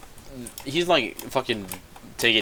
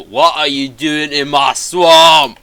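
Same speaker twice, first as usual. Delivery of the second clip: outraged, drawn-out roar, getting much louder and higher; pissed off; 6 semitones higher, 14 dB louder, slower than usual. Retold or imitated. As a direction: bellow it slowly like an angry ogre. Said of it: "And then he's pissed".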